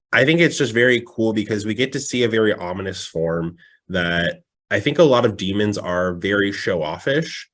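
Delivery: ordinary delivery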